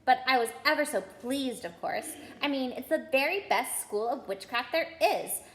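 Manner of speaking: rattled off in a rush; very fast. Acted. Girl chattering at Ron and Harry, rushed and fast. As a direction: gabble it all in one breath. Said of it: "She said this all very fast".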